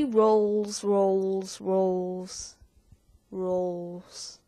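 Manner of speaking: put-on English accent, descending, dying rolls; sinking roll. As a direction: let it sink and roll away. Repeated word sinking away.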